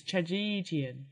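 Trajagean slowly as "tra-JEE-dee-an"; like comedian. Shown as a demonstration. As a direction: sound out Trajagean to rhyme with comedian. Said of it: "It's like comedian".

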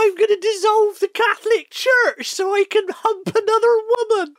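very high squeaky West Country shout; West Country accent. Retold or imitated. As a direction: squeal it like a yokel king. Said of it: "West Country in that impression".

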